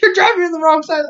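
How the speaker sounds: high-pitched mock-frantic yell; hammed-up alarm; acted panic. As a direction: shriek it as a panicked passenger. Steven panicking at Peridot's driving.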